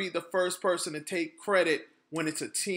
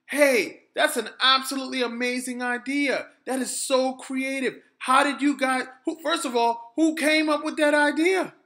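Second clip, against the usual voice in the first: higher, louder call up to the sky; excited bystander yelling upward; 7 semitones higher, 6 dB louder than usual. Retold or imitated. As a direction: shout up to the birds overhead, amazed. Someone yells up at flyers.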